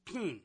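"pin" with a tight, drawn-out "ee" vowel, like "peen"; Australian pin. Shown as a demonstration. Host mimicking Australians merging pin and pen, "peen". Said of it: "For an Australian, it's the same word".

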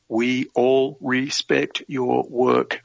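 very slow, one word at a time; deliberate quoting. Retold or imitated. Quoting the officer to the interviewer, slow and deliberate. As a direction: space out "We all respect your work" word by word.

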